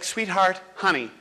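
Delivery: coax, then give up, exhausted; defeated teacher. Retold or imitated. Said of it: "the poor teacher's like".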